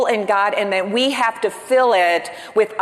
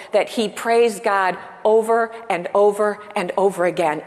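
ordinary delivery

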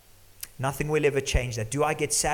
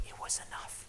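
a whispered sigh of relief; hushed, barely voiced relief; relieved whisper; 14 dB quieter than usual. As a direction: breathe it out in a whisper. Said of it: "and you go".

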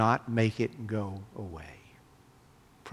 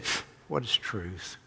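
dismissive puff, then soft, offhand question; flippant; 5 dB quieter than usual. Acted. Preacher voicing Pilate brushing off Jesus, flippant. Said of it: "flippant way".

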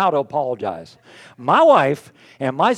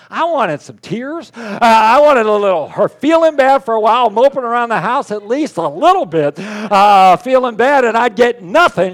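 louder, higher whining complaint, slow start; mock exasperation; 6 semitones higher, 9 dB louder than usual. Real, not acted. Husband complaining about his wife's quick apology: mock whine. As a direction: whine about wanting tears.

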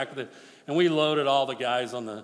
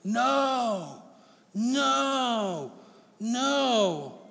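high pleading no's from the flesh; a hint of the flesh protesting; 7 semitones higher, much slower than usual. Preacher voicing his flesh refusing God, pleading. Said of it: "everything in my flesh is going".

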